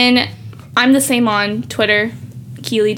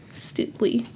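whispering; 12 dB quieter than usual. Real, not acted. Muttered aside about her name.

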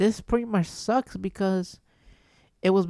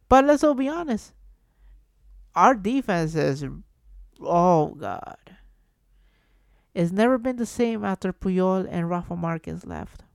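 ordinary talk

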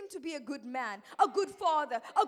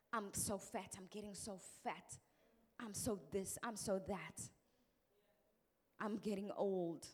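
mock complaining; 7 semitones lower, 8 dB quieter than usual. Imitating people's negative talk.

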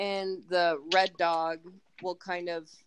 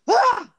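sudden high piercing dog scream; imitated dog scream; 13 dB louder than usual. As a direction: let out a loud sharp yelp like the dog.

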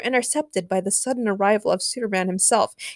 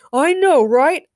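higher, louder exclamation; loud agreement; 7 semitones higher, 8 dB louder, slower than usual. A narrator's reading. The Rock agrees with his outraged opponent, loudly. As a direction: blurt it out in heated agreement. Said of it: "The Rock agreed loudly".

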